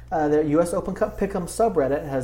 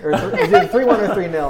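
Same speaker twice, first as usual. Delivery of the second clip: booming big-guy voice, louder; 5 semitones higher, 7 dB louder than usual. Acted. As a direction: puff up into a big tough guy. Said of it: "big guy voice right there?".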